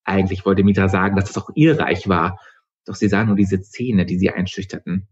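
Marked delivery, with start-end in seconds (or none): none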